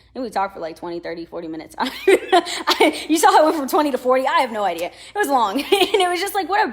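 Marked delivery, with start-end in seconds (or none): 1.8-6.7 laughing through it, higher and louder. Real, not acted. To viewers about the long chat, laughing. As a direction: crack up at own story.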